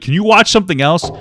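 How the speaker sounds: loud, high mimicry of a viewer; exasperated mocking quote. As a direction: throw the request back, fed up. Repeats viewer's request, visibly irritated.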